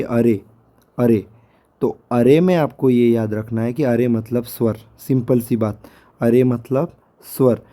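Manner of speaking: ordinary talk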